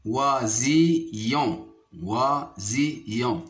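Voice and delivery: "oisillon" slowly as oi, si, yon; syllable by syllable. Shown as a demonstration. Teacher counting syllables for pupils, "oisillon" split in three. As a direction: break "oisillon" into three slow, separate syllables. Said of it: "se compose de trois syllabes".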